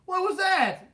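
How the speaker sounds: high, loud retold outburst; outraged. Kid after spitting out chicken heart, outraged. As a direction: act out disgusted disbelief, pitch shooting up.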